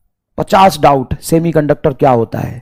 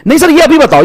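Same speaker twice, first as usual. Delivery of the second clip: higher, louder nagging demand; mocking insistent student; 8 semitones higher, 4 dB louder than usual. Student pestering teacher for an answer, high nag. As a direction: push like a stubborn kid.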